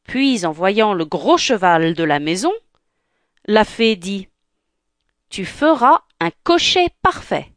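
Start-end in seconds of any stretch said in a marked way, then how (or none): 5.3-7.5 fairy godmother. Acted.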